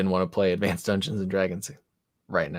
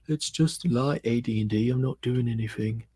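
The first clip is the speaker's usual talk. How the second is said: lightly put-on impression of a humble designer; half-hearted mimicry, waving off praise; bad impression; 3 semitones higher than usual. Game designer modestly waving off a player's praise, bad impression. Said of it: "that was a really bad impression".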